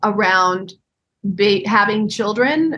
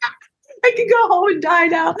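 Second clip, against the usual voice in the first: starstruck gush; 10 semitones higher than usual. Retold or imitated. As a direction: gush it out like you might faint on the spot. Starstruck fan meeting Daniel Day-Lewis.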